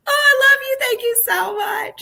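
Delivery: high-pitched, hammed-up swooning thanks; gushing gratitude. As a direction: gush it high, then draw out the thanks. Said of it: "I was so grateful and thankful".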